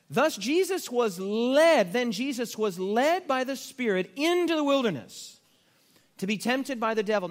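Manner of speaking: scripture reading. Acted. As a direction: read it out with solemn weight. Preacher reading scripture aloud.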